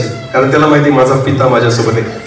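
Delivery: ordinary delivery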